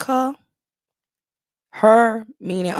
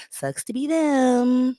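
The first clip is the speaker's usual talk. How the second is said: slow gloat; 7 semitones higher, much slower than usual. Real, not acted. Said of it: "slow gloating remark".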